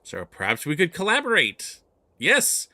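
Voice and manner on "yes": excited yes. Acted. NPC exclaims in delight.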